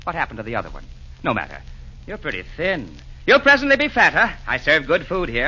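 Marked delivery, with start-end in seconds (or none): 3.3-4.3 jolly burst. Acted. Jolly host fussing over guest.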